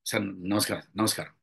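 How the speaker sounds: low, timid repeated greeting; fearful. Shown as a demonstration. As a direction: greet sir nervously, pitched low. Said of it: "అంటే ఒక భయం". (భయంగా)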